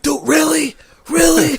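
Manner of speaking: high shouted disbelief, "Dude, really?"; incredulous shout. Retold or imitated. Acting out annoyed person.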